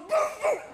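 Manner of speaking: high imitated dog bark; Lassie. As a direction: yelp twice like an alert dog. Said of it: "What's wrong, Lassie?".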